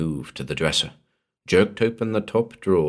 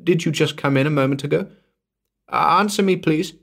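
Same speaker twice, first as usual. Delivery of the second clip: frightened; 10 semitones higher, 3 dB louder, faster than usual. Acted. Frightened woman calls into dark house.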